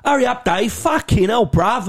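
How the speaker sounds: put-on a rough London accent, thrown off as a parody; mock cockney lad. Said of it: "I heard these fucking cockney lads".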